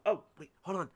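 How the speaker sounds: ordinary delivery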